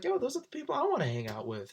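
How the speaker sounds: high bursting enthusiasm; light up, yo!; his eager reaction to the rebels; excited. Retold or imitated.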